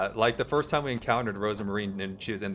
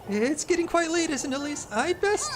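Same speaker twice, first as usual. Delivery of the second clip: much higher, needling squeak; sly nosy neighbour. Acted. Neighbour needling Elise, high sly squeak. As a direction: turn the accusation back slyly. Voicing Miffy.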